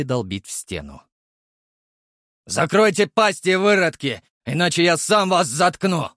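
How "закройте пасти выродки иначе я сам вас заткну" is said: loud hostile neighbour yell; gruff angry shout. Acted. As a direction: bark threats through the wall. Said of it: "крайне неприветливо сообщил мужской бас".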